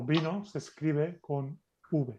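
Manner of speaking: normal talking voice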